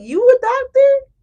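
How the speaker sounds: high, loud, slow disbelieving question; doubtful high question. Retold or imitated. Viewer doubting a stranger who claims to be a doctor, incredulous high voice. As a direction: ask it like you can't believe them. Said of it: "But you like".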